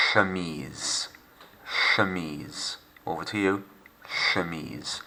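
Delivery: "Chemise" softly with a French SH sound; quiet model, the CH as a soft sh; "Chemise" said shuh-meez, quiet and gentle. Shown as a demonstration. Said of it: "The C and the H is soft".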